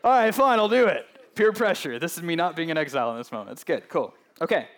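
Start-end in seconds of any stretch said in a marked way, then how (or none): 0.0-2.0 loud, high, exasperated give-in; playful loud concession to the congregation; mock surrender. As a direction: throw up your hands and cave, hammed-up. Giving in to the crowd, mock.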